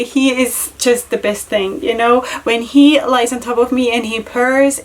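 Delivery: ordinary delivery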